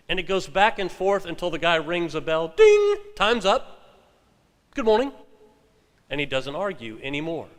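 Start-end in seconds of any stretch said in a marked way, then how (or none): 2.5-3.0 a very high, loud imitated bell "Ding!". 3.2-5.1 higher, brisk shopkeeper arguer; a lightly voiced clerk closing the session; arguer's voice. The arguer ends the argument.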